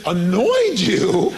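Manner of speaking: loud, drawn-out disbelieving echo; mock-baffled, rising question; incredulous. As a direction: repeat it back in stretched-out astonishment. Disbelief at friend's word in retold exchange.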